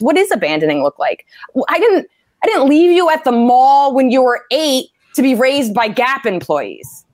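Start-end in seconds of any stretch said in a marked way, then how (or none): 1.5-7.0 mock outraged rant. Ex who says abandoned, a higher indignant mock rant. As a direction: tear into him, half laughing. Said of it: "ranting at ex in bit".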